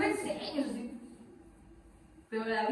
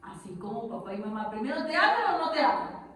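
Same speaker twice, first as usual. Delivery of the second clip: ordinary talk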